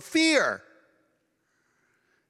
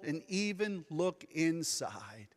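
whispered; 5 semitones lower, 9 dB quieter than usual. Acted. Awed hush at climax.

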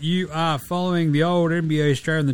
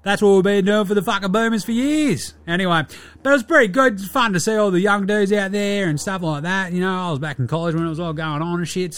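higher-pitched brash Aussie baller, crude and cocky; old mate Dilly; 3 semitones higher than usual. Acted. Dilly the NBA player rants to listeners about the Redeem Team doco, higher and brash. Host plays character Dilly.

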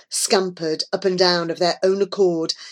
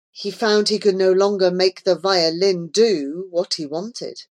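usual speaking voice

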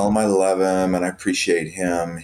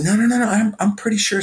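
high excited insistence; higher, excited no no no; eager insistence; 3 dB louder, faster than usual. Retold or imitated. Insisting to the guard.

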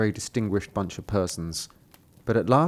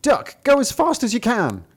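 high, urgent rooster call; high rooster command; 12 semitones higher, 3 dB louder than usual. Acted. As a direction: spur the duck on, high-pitched. Cock urging duck on.

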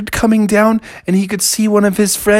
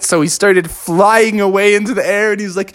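ordinary delivery, nothing marked

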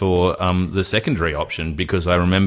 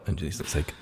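ordinary delivery

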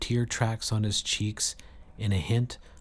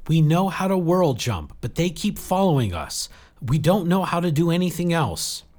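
high, insistent, raised voice; urgent plea; 8 semitones higher, 5 dB louder than usual. A narrator's reading. Jill pleading with Saradwan for help, urgent. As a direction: push past the objection and plead for help.

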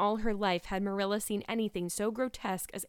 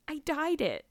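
put-on higher-pitched, girlish admission, falling away; reluctant confession; 7 semitones higher than usual. A narrator's reading. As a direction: own up miserably. Anne confesses to Marilla.

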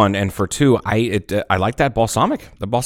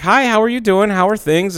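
sweet checker lady; 12 semitones higher than usual. Retold or imitated. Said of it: "She's like".

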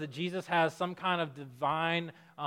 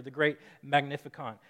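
usual speaking voice

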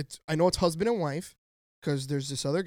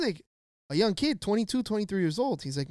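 usual speaking voice

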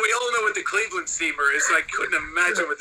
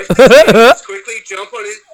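laughing; 6 semitones higher, 17 dB louder than usual. Real, not acted. Breaks up while reading.

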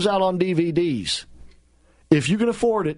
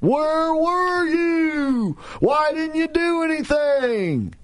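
high, long-drawn shrill wail; mock shrill screaming; 12 semitones higher, much slower than usual. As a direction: stretch each word in a high mocking screech. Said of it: "screamed at the top of their shrill voices".

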